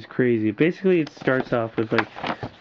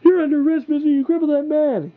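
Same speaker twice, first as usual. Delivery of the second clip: high, louder hammed-up cop shouting an arrest; squeaky indignant officer yelling; mock arrest shout; 5 dB louder than usual. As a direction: bark the arrest like an outraged officer. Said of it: "Batman's like".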